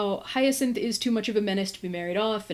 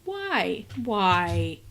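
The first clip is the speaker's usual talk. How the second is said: exasperated; 2 semitones lower than usual. Real, not acted. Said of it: "I just kicked my camera".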